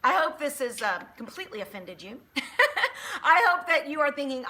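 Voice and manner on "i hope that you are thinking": louder, laughing through the words. Real, not acted. Preacher teasing her viewers, laughing through it. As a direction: laugh as you tease them.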